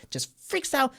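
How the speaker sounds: ordinary talk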